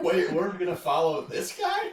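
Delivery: high, laughing disbelief; mock incredulous apostle. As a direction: play a stunned apostle half laughing. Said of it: "They're like".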